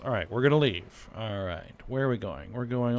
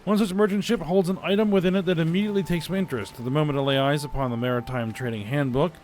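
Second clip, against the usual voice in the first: lively, fast dramatic reading; dramatic event reading; 4 semitones higher, 5 dB louder, faster than usual. Acted. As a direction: read it like an adventure tale. Reads game event text aloud.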